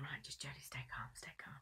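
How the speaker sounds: hushed, whispered pep talk to oneself; whispered self-talk. Retold or imitated. Singer to herself before the audition, whispering. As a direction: whisper it privately to calm your own nerves.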